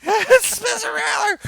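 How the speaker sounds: very high, loud fan screaming; freaking out. Retold or imitated. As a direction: re-enact a star-struck freak-out at full volume. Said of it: "I flipped out".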